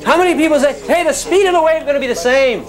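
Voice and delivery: high, loud class vote call; calling out a poll. Acted. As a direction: pitch it high and call to the room.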